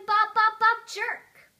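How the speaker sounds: high, loud rhythmic chant ending in a jolt; animated mimicry. Bored child mimicking a nodding churchgoer, animated and loud. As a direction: bounce each word out high and loud.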